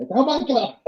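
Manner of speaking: very high-pitched panicky imitation; hammed-up cry of horror; mock freak-out. Reenacting the recordists on tape, mock freaking out. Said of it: "like, freaking out".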